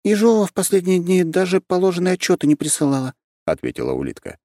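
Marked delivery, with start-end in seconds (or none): 0.0-3.1 lightly voiced young girl; high girl voice. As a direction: speak up high like a small girl. Girl voice continues.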